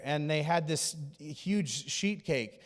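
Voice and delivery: normal talking voice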